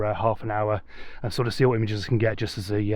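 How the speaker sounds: ordinary talk, nothing marked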